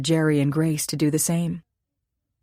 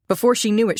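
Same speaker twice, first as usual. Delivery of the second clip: ordinary delivery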